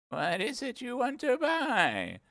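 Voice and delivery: put-on a fussy old sheep at her counter. A narrator's reading. The sheep asking Alice what she wants, old sheep voice.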